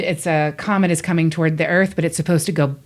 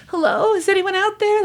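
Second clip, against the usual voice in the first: much higher, calling out for help over the radio; desperate ham radio caller. Acted. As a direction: plead into the static, alone in a cabin. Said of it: "a character on a ham radio".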